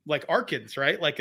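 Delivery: ordinary talk, nothing marked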